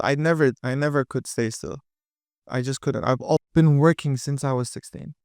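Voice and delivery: ordinary talk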